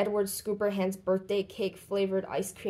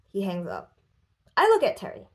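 usual speaking voice

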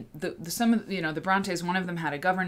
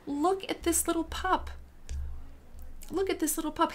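cooing at a pup; 11 semitones higher than usual. Real, not acted. Showing a drawing of a dog.

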